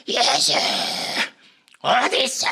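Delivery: a loud, high, imitated yell into the air; desperate shout. Preacher reliving yelling for Jesus at the cliff, a loud desperate call. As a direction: re-enact crying out from the cliff. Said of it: "이러면서 막 소리쳤어요".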